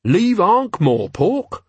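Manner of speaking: slow, high, incredulous question. A narrator's reading. Carrot asked about leaving his city, incredulous. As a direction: ask it as if unthinkable, pitched right up. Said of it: "The tone of voice included the answer".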